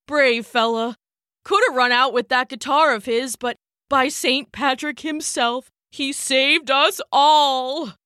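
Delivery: a grown man fighting tears; tearful Irish man. Acted. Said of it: "near tears".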